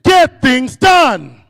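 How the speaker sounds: slow, loud rallying shout; shouted slogan. Shown as a demonstration. Speaker leads the audience in a chant, shouting slowly. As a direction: bellow it out for the crowd to echo. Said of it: "say this after me".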